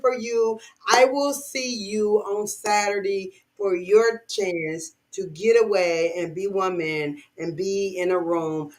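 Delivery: ordinary talk, nothing marked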